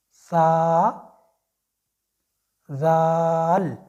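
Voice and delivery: सा, जाल said light and thin; soft letters. Shown as a demonstration. Teacher demonstrating the red letters, soft and light. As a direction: keep सा and जाल light, without heaviness. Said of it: "इनको हमने soft पढ़ना है".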